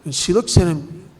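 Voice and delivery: ordinary talk, nothing marked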